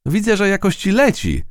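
high cheerful call upward. A narrator's reading. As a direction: shout up to someone flying by. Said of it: "krzyknęła do lotniczki".

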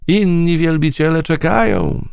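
high, airy butterfly call; called from flight. A narrator's reading. Butterfly calling back while flying away, high. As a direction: sing it out as you fly off. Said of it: "zawołała w locie".

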